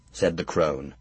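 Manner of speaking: ordinary delivery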